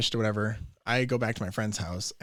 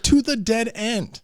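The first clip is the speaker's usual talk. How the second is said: loud, high and slow, spelling out the obvious; sarcastic loud reply; 12 semitones higher, 8 dB louder, slower than usual. Retold or imitated. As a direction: drag it out as if they were dumb. His sarcastic answer to friends.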